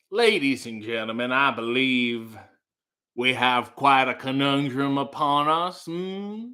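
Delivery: lightly voiced courtroom lawyer, slow and grand. Lawyer addressing the jury on the house dispute, slow and grand. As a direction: address the jury like a pompous attorney, slowly. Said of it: "let me do the lawyer".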